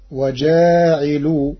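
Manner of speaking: "وجاعل" slow, as waja'ilu with long a; alif after jim. Shown as a demonstration. Teacher showing Warsh's reading to listeners, long vowel after jim. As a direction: stretch the jim of "وجاعل", i on ayn, u on lam. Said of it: "بألف بعد الجيم وكسر العين ورفع اللام".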